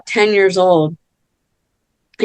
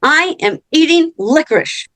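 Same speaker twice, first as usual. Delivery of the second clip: put-on indignant kid; 4 semitones higher than usual. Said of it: "He goes".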